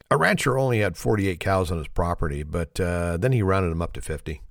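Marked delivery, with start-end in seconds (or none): none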